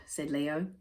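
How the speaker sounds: ordinary delivery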